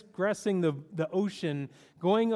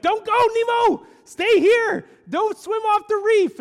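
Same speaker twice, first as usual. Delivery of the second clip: high, loud frantic shout to a drifting son; panicked father yelling; 10 dB louder than usual. Retold or imitated. Marlin shouting at Nemo swimming away, panicked high yell. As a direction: yell it like a terrified dad. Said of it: "Marlin's like".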